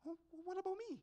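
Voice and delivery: high, small, hard-done-by voice; plaintive protest. Retold or imitated. Boy feeling left out by his uncle's catch, plaintive protest.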